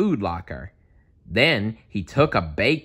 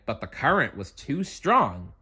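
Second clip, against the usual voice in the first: plain reading voice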